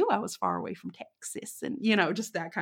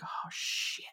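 whispered under the breath; whispered mock panic; 12 dB quieter than usual. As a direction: hiss it to yourself in dread. Quiet panicked realization.

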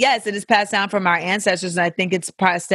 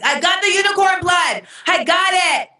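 high, breathless mock triumph; hammed-up cry of 'got it'; mock excited triumph; 11 semitones higher than usual. Mock rushing unicorn blood to hospital.

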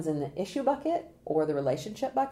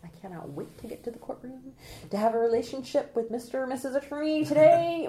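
mock-eager juror, higher pitched and sing-song; mock eagerness; 6 semitones higher than usual. Consultant mocking an impossibly keen juror, mock eagerness. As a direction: gush with pretend excitement. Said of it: "No one gets a jury summons in the mail and goes".

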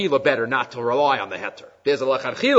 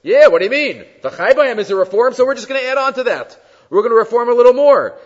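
louder, higher mock reformer; 6 semitones higher, 6 dB louder than usual. Rabbi mimicking eighteen-hundreds reformers, mock dismissive. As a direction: ham up the reformer brushing it off. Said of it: "certain Reform rabbis in the eighteen hundreds".

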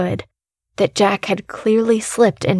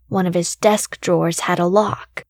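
ordinary delivery, nothing marked

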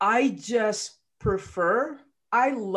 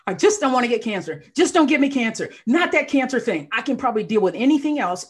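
pleading; 5 semitones higher, 6 dB louder, faster than usual. Retold or imitated. Retold fearful plea.

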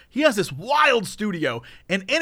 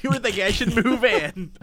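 imitating mom; 6 semitones higher than usual. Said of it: "She's like".

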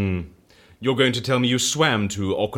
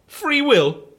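pitched way up, mocking disbelief; incredulous mockery; 12 semitones higher, 3 dB louder than usual. Scoffing at the dice man's claim to free will, incredulous. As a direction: throw the words back at him in scorn.

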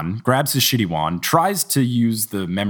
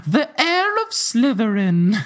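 dramatic title; 12 semitones higher, slower than usual. Acted. Announcing the chapter title.

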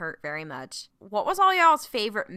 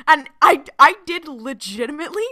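higher and much louder, laughing through the words; laughing while telling; 7 semitones higher, 12 dB louder than usual. Real, not acted. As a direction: giggle through the confession. Laughing at own emotional story.

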